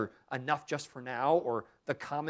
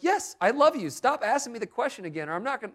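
high, loud, fed-up yes; mock irritation at being asked again; exasperated outburst, pitch jumping high; exasperated husband; 8 semitones higher, 6 dB louder than usual. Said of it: "And I was like".

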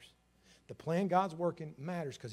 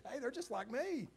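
laughing pride; 9 semitones higher than usual. Retold or imitated. Proud grandparent laughing.

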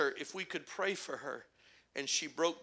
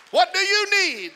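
Savior's call; 12 semitones higher, 11 dB louder than usual. Retold or imitated. Repeated, Savior voice louder.

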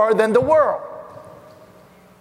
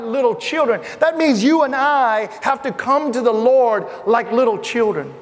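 ordinary talk, nothing marked

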